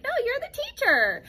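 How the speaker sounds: high, loud mock principal; principal's correction. Principal telling her she is the teacher, high loud mock. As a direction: announce it like the principal. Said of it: "was like".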